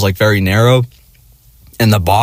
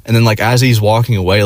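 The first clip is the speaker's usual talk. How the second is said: ordinary delivery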